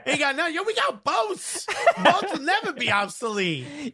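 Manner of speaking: high, laughing boast about boats; boasting Roman sure his boats will never be obsolete; hammed-up Roman boast. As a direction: brag about boats like they're unbeatable. Rome role play continues.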